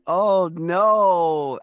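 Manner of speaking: ordinary talk, nothing marked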